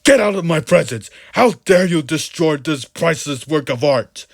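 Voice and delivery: furious shout. A narrator's reading. Priest raging at Mister Jones, shouting. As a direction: bellow it in a rage. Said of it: "shouted the priest".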